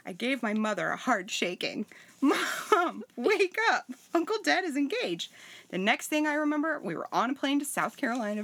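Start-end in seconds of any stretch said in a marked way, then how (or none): 2.2-5.3 excited. Retold or imitated. Shaking mother awake, excited.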